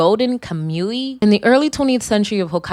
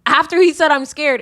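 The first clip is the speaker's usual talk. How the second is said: 1 incredulous; 8 semitones higher than usual. Retold or imitated. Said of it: "I was like".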